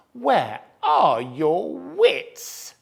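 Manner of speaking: slow, loud taunt; mocking. A narrator's reading. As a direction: drag it out mockingly.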